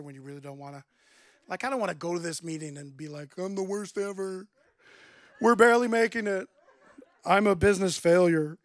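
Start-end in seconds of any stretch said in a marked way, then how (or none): 3.4-8.6 mock despair. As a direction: overplay the self-pity. Imagined confession at meeting.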